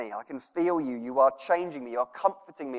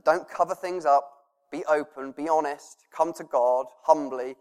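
ordinary talk

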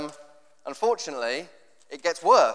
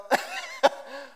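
laugh; 11 semitones higher than usual. Pretend. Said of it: "you think".

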